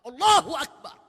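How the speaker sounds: loud, rousing takbir; spirited takbir. Shown as a demonstration. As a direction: proclaim it with full spirit. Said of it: "Penuh semangat". (takbir lantang penuh semangat)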